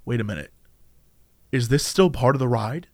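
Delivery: a ride guest doubting reality, hushed; hushed realization. Retold or imitated. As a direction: say it low, as if catching on to a secret. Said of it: "they pause and they're like".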